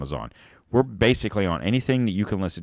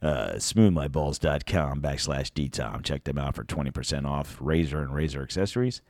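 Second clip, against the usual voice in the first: sultry voice; 4 semitones lower, 4 dB quieter than usual. Acted. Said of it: "Gotta get my sultry voice on".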